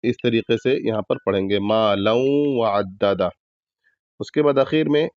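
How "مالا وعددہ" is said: وعددہ with double dal said gently and slowly; slow recitation. Shown as a demonstration. Teacher reciting slowly for practice. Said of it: "آرام سے ادا کرنا ہے".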